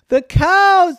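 high loud thrilled cry; loud high delighted shout; excited call. Acted. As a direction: call out overjoyed. Joyful call to cows.

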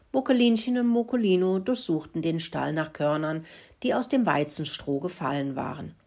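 ordinary delivery

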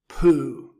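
loud, dismissive snort. A narrator's reading. Bear dismissing the rabbit's boast, snorting. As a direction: burst it out in scorn. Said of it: "the bear snorted".

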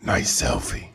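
low creepy hushed line; lightly voiced sinister stalker; horror villain voice. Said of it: "Oh, you mean Candyman?".

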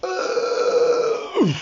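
breathy effortful grunt; strained grunt. Acted. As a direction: grunt from straining too hard. Lara strains and falls.

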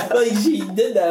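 talking through laughter; laughing. Real, not acted. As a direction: laugh while you say it.

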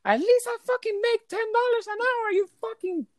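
very high, yelled re-enactment; retold screamed comeback. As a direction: scream back at the guest, retelling it. Acted screamed comeback to guest.

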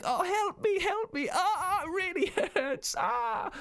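high, laughing mock wail; imitating crying. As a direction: squeal it like a hurt kid, half laughing. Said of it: "and then he was crying".

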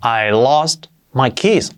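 "I lost my keys" slow and careful; slow clear "I lost my keys"; slow model sentence. Shown as a demonstration. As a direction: model it slowly for learners. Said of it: "pues sería".

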